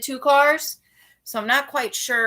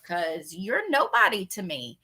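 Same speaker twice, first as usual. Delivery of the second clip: ordinary delivery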